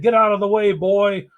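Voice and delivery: high, loud royal command; king's order. Acted. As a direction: bark the order down at the boy. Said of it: "ordered the king".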